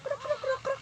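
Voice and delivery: high clucking chicken noise. Shown as a demonstration. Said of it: "What is chicken sounds?".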